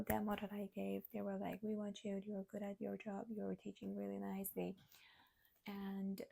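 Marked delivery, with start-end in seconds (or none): none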